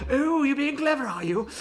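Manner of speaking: high, loud mock menace; a judge suddenly turning sinister, hammed up; hammed-up sudden villain. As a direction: sneer it like a cartoon villain turning on her. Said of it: "He's like".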